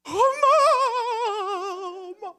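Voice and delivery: high, loud wail of grief; aunt wailing over her mother's body; wailing cry. Retold or imitated. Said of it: "막 오열을".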